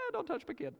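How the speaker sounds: high, fast mock mom voice; a mother shielding her newborn from strangers, squeaky and quick; protective mom. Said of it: "You're like".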